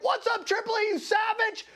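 high, shouted phone greeting; Joe Rogan. Retold or imitated. Famous comic greeting his friend on speakerphone, high boisterous shout. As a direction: hail a buddy in a high mock shout. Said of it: "and it's Joe Rogan".

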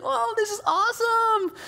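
high gushing voice; excited young mom. Retold or imitated. Mom at youth camp gushing, high and excited. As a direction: gush like a thrilled camper. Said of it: "telling everybody, like".

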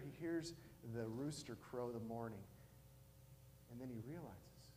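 ordinary talk

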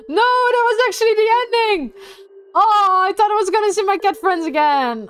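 high, loud cry of protest; dismayed outcry. Real, not acted. Player upset at the ending without cat friends, loud dismayed outcry. Game ends without the hoped reunion.

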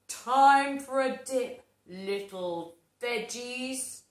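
loud mocking villain; taunting villain. Evil pea taunting veggies, loud and mocking. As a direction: sneer at the veggies.

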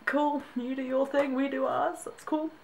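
high, airy brush-off; mock agreeable. Brit dodging the American education system, mock agreeable. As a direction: go along with it in a high voice. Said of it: "I'm just gonna go".

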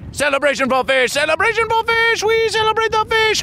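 rising to a high, excited cheer; mock celebration. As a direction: hype up the fish. Host celebrates the fish answer.

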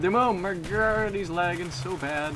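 loud, high disbelief; exasperated outburst. Real, not acted. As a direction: blurt out the frustration. Excited outburst at lag.

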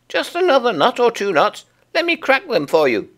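very high coaxing chipmunk; high, coaxing little chipmunk. Acted.

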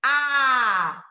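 a shouted open from the throat. Shown as a demonstration. Teacher demonstrating the unblocked a sound. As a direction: push the a out from the throat. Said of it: "und danach, als".

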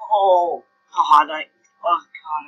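loud, sudden oh God; startled cry. Real, not acted. Gamer yelping at the screen, startled and loud. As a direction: blurt it out as the game goes wrong. Player panics as the character dies.

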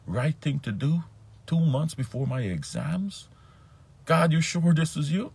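incredulous. Retold or imitated. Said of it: "I said".

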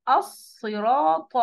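very slow, loud "الصراط" with heavy ص and ط; emphatic recitation. Shown as a demonstration. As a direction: stretch "الصراط" out, mouth full on ص and ط. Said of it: "بس أقولها زي أنا".

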